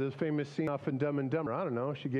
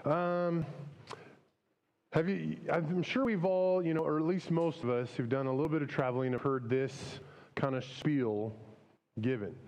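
ordinary talk, nothing marked

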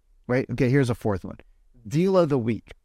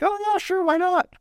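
squeaky, much higher put-on voice; mock eager agent. Agent asked for filming help, answering in a high mock-eager voice. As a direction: play the other agent as an eager yes-man. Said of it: "They'd be like".

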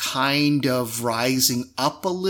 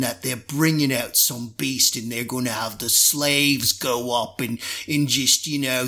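bored Cockney pirate chatter; Cockney drow. Acted. Drow guard grumbling to his mate about the fights, in Cockney. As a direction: gossip idly in a Cockney accent. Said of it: "speaking with this kind of Cockney accent".

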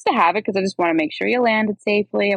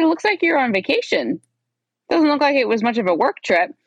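high-pitched mock-doubtful challenge; pointedly unconvinced, acting out the exchange; retold skepticism; 8 semitones higher than usual. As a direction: question his story in a raised pitch. Retells challenging his work-trip claim.

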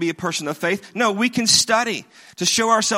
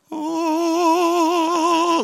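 mock solemn oh; 11 semitones higher than usual. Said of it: "He was like".

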